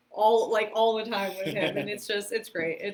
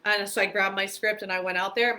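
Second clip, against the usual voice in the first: ordinary talk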